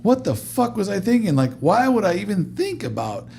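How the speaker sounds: high-pitched, exasperated mock rant; retold tirade, slowing to punch out the insult; going off. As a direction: imitate a director losing it. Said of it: "he's just going off".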